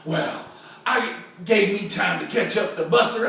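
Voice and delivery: plain reading voice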